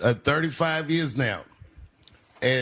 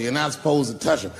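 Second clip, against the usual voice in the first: normal talking voice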